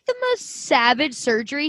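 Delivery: ordinary talk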